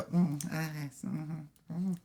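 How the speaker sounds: soft, high little hums; cute reaction noises. Retold or imitated. Actress reacting to her co-star's stumbling, cute little hums. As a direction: murmur tiny wordless reactions. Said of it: "cuter at reacting to his stuff".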